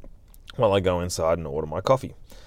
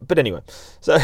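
ordinary talk, nothing marked

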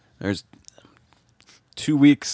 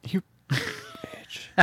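whispered insult; 9 semitones higher, 10 dB quieter than usual. Real, not acted. Mock insult after the lie.

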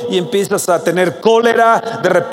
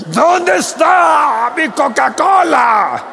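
slow, much higher shouted demand; furious shout; 8 semitones higher, 3 dB louder, much slower than usual. Acted. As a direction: roar it out as if someone stole your soda. Acting out furious soda owner. (grito furioso, lento y mucho más agudo)

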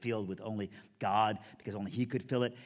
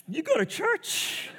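very high, loud, slow squeezed voice; mock stoned disbelief; 8 dB louder, much slower than usual. Stoned teen questioning his buddy's churchgoing, mock squeaky stoner voice. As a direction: squeak it out as if holding in smoke. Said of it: "And I'm like".

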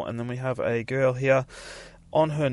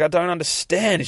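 higher, mildly raised cry of confusion; mock scream; 8 semitones higher, 4 dB louder than usual. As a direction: imitate a girl screaming, held back. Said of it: "she's screaming at the demon".

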